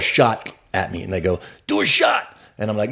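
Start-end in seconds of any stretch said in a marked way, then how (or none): 1.7-2.4 high rowdy shout; heckling shout. Retold or imitated. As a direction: yell it from the crowd. Drunk crowd heckling.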